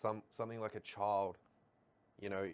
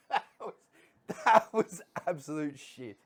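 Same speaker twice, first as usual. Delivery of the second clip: laughing; 6 semitones higher, 7 dB louder than usual. Real, not acted. Laughing at the bad accent.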